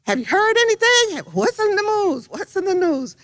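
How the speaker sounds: high-pitched, retold pestering questions; eager questioning. Sorority president calling daily for news, high eager re-enactment.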